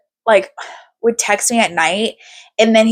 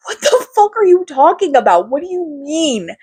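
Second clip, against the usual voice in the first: high, incredulous "what the fuck"; incredulous outburst; 7 semitones higher than usual. Retold or imitated. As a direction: throw it back in mock outrage. Imagined reaction to boyfriend.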